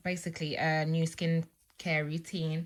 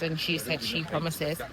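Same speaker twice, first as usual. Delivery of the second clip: ordinary talk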